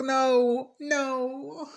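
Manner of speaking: high, fading teary no; mock whimpered no. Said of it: "I was like".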